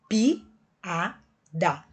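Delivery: piada split into three slow syllables, pi, a, da. Shown as a demonstration. Teacher shows pupils how piada separates, syllable by syllable. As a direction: say piada with a clear break after each syllable. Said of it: "quando eu separar".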